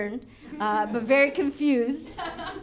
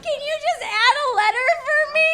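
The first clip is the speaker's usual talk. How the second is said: much higher, whiny, fast, ending in a laughing sob; mock confused millennial; 14 semitones higher, faster than usual. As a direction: play a flustered, tearful millennial. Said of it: "every time a millennial cries, we're like".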